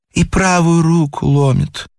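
lightly voiced lady listing her ailments; complaining lady. A narrator's reading. Lady interrupts the doctor with her symptoms, complaining. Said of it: "перебила дамочка".

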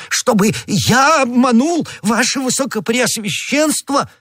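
very high, drawn-out indignant protest; shocked outcry. A narrator's reading. Bonacieux protesting to the cardinal that he would never deceive him, shocked outcry. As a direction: squeal in hammed-up disbelief. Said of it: "вскричал Бонасье".

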